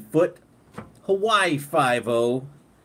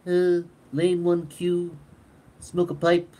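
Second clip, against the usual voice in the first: Stewie impression; 6 semitones higher, slower than usual. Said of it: "you got Stewie".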